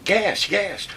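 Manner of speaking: loud, high alarm call; hollered warning. Retold or imitated. Soldier hollering a gas alert to the column, loud alarm. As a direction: yell it down the marching column.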